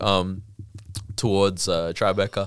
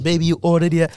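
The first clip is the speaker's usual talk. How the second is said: higher, motherly imitated soul food lady; restaurant lady; 7 semitones higher than usual. Soul food restaurant owner greets the tourist, motherly. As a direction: call him baby, sweet and familiar. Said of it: "She comes out, she's like".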